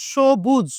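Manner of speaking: সবুজ said 'shobuj' with palatal sh; teacher's careful 'sho-buj' for সবুজ; correct sh pronunciation. Shown as a demonstration. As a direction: model সবুজ clearly, opening on sh. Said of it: "এই উচ্চারণটার নাম কিন্তু হচ্ছে".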